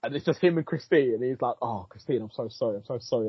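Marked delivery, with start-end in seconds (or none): 1.6-3.3 softer, lightly imitated tearful apology; mock crying. Retelling Doctor Strange apologising to Christine, softly mock-tearful. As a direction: retell a broken apology, understated and quiet. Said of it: "like, crying".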